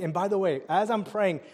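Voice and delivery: ordinary talk, nothing marked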